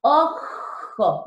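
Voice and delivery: OJO with a long scraping J; rasping J. Shown as a demonstration. Teacher showing that the J scrapes, rasping OJO. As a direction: drag the throaty J in OJO. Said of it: "Dense cuenta que raspa".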